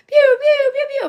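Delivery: very high, loud pew pew noises; radio host soundboard pews; mock laser sound effect. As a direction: fire off cartoon laser blasts.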